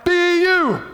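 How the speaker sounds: high, loud held-out letters; big high-pitched cheerleader finish; fight song cheer. Retold or imitated. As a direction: shout the closing cheer to the crowd.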